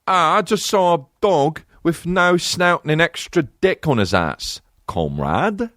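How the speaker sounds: slow, high British caricature; mock British accent. British actor as a Russian comrade describing a dog, mock British accent. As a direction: draw out a hammed-up British accent. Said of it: "literally British".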